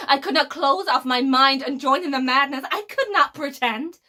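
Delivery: loud, forceful outburst; vehement refusal. Acted. Villain refusing to join the madness, loudly vehement. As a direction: burst out in defiance, building in pitch.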